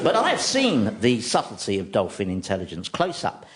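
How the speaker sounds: normal talking voice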